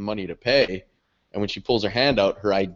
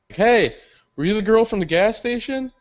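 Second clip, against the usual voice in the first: raised, higher-pitched hail; calling after someone; 10 semitones higher, 4 dB louder than usual. Retold or imitated. Catching up to girl from gas station, acted hail. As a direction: call out to catch a stranger's attention.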